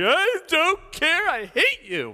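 slow, high, loud tantrum wail; bratty kid. Acted. Child denied his birthday present yelling at his parent, high and loud. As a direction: stamp your feet like a spoiled child. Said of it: "didn't give him the present that he wanted".

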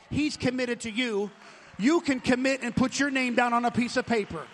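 loud, rising sermon voice; full-force preaching. Acted. Pastor urging the church to sign commitments, loud preaching. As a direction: drive it home to the congregation.

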